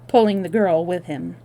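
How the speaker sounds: usual speaking voice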